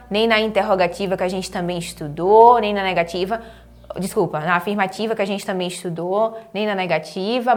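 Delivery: normal talking voice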